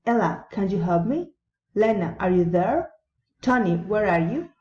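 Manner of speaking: name first, then climb to "me", "there", "you"; addressing someone, question swept upward on "me", "there", "you"; rising intonation. Shown as a demonstration. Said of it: "rising intonation".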